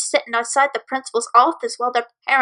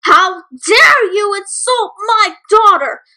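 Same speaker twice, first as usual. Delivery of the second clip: higher, louder, slow outrage; furious yell; 8 semitones higher, 6 dB louder, slower than usual. Acted. Mother confronting the bully's mom, a slow furious yell. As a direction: defend her daughter fiercely. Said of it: "Sugar yelled".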